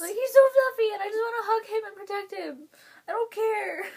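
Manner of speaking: high, emotional fangirl squeal; gushing adoration. Real, not acted. Fan wanting to hug and protect singer, gushing adoration.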